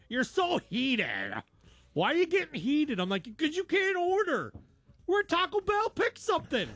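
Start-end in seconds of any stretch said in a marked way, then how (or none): 0.1-3.0 high, slow teasing daughter voice. Retold or imitated. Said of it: "That's her word". 3.4-6.7 high, pushed exasperated snap; fed up with the kids in line; exasperated dad. Retold or imitated. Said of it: "dad snapping at kids".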